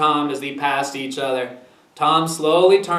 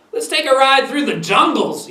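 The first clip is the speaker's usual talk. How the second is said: higher-pitched, playful proposal; playful invitation; 7 semitones higher than usual. A narrator's reading. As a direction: invite the child along on an adventure. Grampy proposes an adventure ride.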